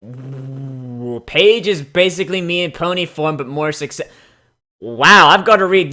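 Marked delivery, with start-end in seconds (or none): none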